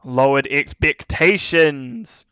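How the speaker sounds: fast, rising match commentary; live play-by-play. Acted. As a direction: call the action as it happens.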